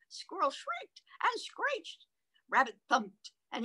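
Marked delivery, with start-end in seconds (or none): 0.1-2.0 high, soft, drawn-out squeal; stretched squeaky storyteller's screech; mock squirrel screech. A narrator's reading. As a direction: narrate it in a slow high squeak. Narrator acts out squirrel's shriek.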